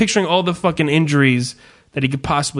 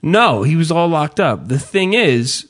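ordinary talk

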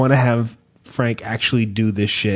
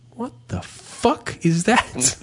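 bewildered shopper; 3 semitones higher, 5 dB quieter, slower than usual. Retold or imitated. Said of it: "I heard him say".

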